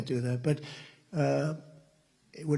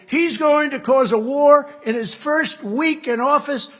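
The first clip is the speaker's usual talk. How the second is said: mocking critics; 11 semitones higher, 8 dB louder, slower than usual. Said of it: "they said".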